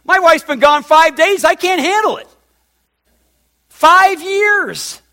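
high, loud amazed outburst; half-joking outburst of astonishment; exclaiming. Real, not acted. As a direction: cry out in disbelief at the length. Marveling at five years apart.

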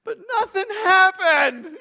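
high, loud and slow with a laugh; laughing quote. Retold or imitated. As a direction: laugh at the letdown. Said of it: "And she's like".

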